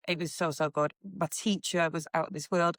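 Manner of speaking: ordinary delivery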